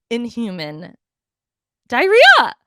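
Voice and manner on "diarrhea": high, loud outraged yelp; a sudden squeal of horror; disgusted shriek. Real, not acted. Climax of the disgusting story.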